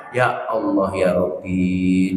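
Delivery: low, slow weary sigh to God; exasperated sigh. Retold or imitated. Preacher sighing at complaining wives, low and slow. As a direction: shake your head and sigh it out slowly.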